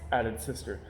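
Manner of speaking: ordinary delivery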